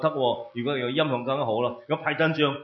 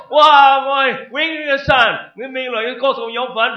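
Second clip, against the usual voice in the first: high, loud burst of amazement; overjoyed exclamation; 10 semitones higher, 9 dB louder than usual. Acted. Preacher showing how a believer should rejoice, overjoyed exclamation. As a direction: cry out in wonder at the good news.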